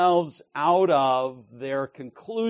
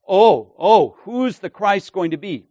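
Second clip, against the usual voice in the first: loud, higher "oh, oh" of someone clueless; mock cluelessness; 4 semitones higher, 9 dB louder than usual. Preacher voicing the people's silly notions, mock cluelessness. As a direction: mimic silly, puzzled people.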